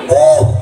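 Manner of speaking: a sudden high wail, hammed up; high mock wail. Shown as a demonstration. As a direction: yelp like a grieving widow, mock. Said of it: "Nangisnya begini".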